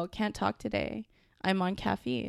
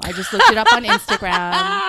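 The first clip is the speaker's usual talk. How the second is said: laughing; 10 semitones higher, 10 dB louder than usual. Real, not acted. Admitting her source, laughing.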